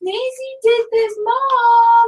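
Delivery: put-on high whiny child calling Mom; yelling across the room like a kid. Kids interrupting, voiced by S1.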